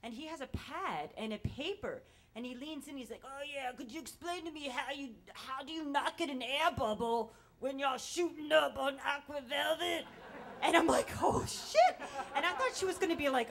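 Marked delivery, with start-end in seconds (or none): 3.2-10.0 higher, louder scrawny hippie impression; strung-out hippie. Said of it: "strung out hippie guy". 11.2-12.0 very high, loud "oh shit"; shocked reaction. Retold or imitated.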